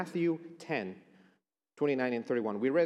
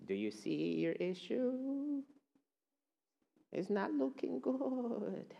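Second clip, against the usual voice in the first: soft, slow, sneering taunt; the enemy's taunting voice; 7 dB quieter, slower than usual. Acted. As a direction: whisper doubts at them like a sly tempter. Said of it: "the voice sounds to me".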